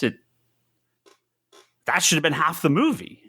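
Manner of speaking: ordinary talk, nothing marked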